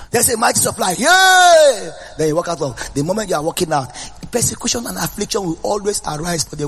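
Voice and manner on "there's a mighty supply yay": high, loud joyful shout. Real, not acted. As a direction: shout it out to the congregation. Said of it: "ah, shouting now".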